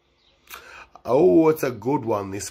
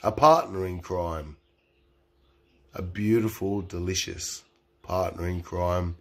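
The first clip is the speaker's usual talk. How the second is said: sultry; 6 semitones lower, 6 dB quieter than usual. Acted. Sultry intro of a woman.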